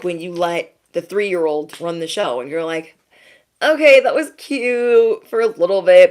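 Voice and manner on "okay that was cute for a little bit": louder, higher voiced parent reaction; exasperated parent. Parent reacting to a three-year-old running the show, exasperated and loud. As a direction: raise your voice as a fed-up parent.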